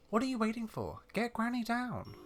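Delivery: high-pitched, impatient granny demand. Acted. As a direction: order the youngsters to hurry. Old lady demands to be freed.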